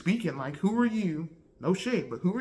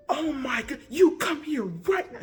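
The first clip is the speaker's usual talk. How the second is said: imitating angry teacher; 9 semitones higher than usual. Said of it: "She was mad, bro".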